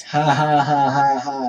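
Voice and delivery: fake, loud mock laugh; forced laugh. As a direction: push out a deliberately fake laugh. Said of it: "forced laugh".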